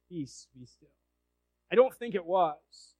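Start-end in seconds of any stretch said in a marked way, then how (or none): 0.1-0.9 soft low whisper of the command; hushed, barely voiced peace be still. Shown as a demonstration. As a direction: breathe it like a still small voice. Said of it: "was it a whisper? ... still, small voice".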